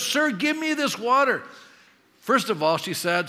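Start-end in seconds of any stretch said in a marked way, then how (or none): 0.0-1.4 high, loud, drawn-out plea; eager request with every word pushed up high and strong; excited. Acted. Preacher acting out the woman asking Jesus for water, excited. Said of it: "I think she was excited".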